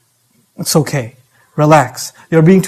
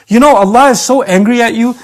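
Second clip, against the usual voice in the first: ordinary delivery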